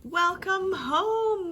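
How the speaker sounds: ordinary delivery, nothing marked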